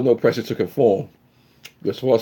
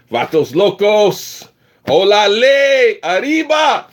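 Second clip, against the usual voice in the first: rising, drawn-out hype shout; Chicano shout-out; 14 semitones higher, 5 dB louder, much slower than usual. Acted. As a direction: call it out louder and higher. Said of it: "for my Mexican people".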